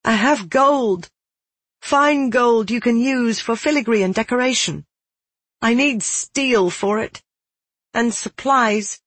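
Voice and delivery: high, earnest, pleading offer; urgent bargaining. Acted. Girl offers gold through the door for steel, pleading.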